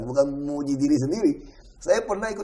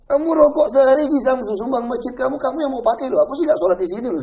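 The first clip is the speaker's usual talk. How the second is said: loud, higher mock scolding; retold scolding; 9 semitones higher, 6 dB louder than usual. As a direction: scold them for being stingy with the mosque. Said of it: "saya bilang". (menegur dengan keras, nada tinggi)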